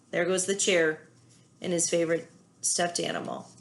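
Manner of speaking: ordinary talk, nothing marked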